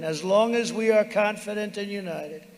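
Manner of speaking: usual speaking voice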